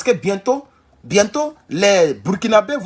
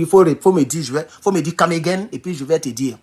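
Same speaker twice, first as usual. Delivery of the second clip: usual speaking voice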